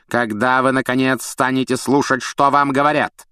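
cold teacher scolding a fool; scornful scolding. Acted. Snape berates Neville.

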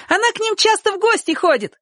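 very high gleeful squeal; joyful shout. A narrator's reading. Sonka delightedly backs Chik, high joyful cry. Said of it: "крикнула Сонька и даже подпрыгнула от радости".

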